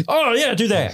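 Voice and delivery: high, sarcastic orc boss; mock orc. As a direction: toss it off like a lazy boss. Acted orc leader reply.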